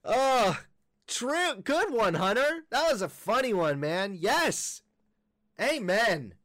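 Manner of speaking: mock-delighted, high-pitched praise; over-the-top high agreement; mock approval. Real, not acted. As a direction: cheer along in a high, exaggerated voice. Mock praise of played clip.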